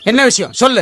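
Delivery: ordinary delivery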